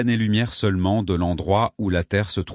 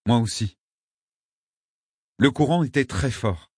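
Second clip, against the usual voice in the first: usual speaking voice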